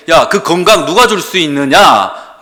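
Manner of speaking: loud, slow booming challenge; God rebuking. Retold or imitated. God challenges the excuses, loud and slow. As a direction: press him with the question. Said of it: "라고 말씀하시는 거예요".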